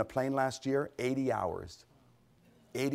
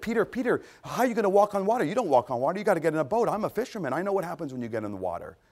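incredulous protest; 5 semitones higher, faster than usual. Acted. Scoffing at walking on water, high incredulous protest. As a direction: object as if it's plainly absurd.

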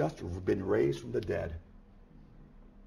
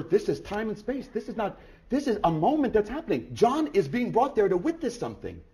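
normal talking voice

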